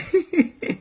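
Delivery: a high breathy laugh at her own joke. Real, not acted. Laughing at her own joke about Molière. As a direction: laugh it off, amused.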